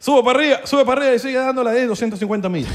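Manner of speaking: high, loud mock directions; calling out directions. Mimics someone calling out directions.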